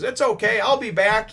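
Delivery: high, loud cheerful shout; breezy call. Retold or imitated. Nami waving to the villagers, calling back high and loud. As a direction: call it back carelessly across the water.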